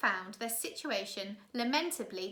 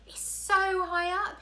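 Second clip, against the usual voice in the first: put-on higher, slow, louder boy; awed boy; 9 semitones higher, 5 dB louder, slower than usual. Klaus looking down from the tower window, slow and awed. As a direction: gaze down in awe as a boy.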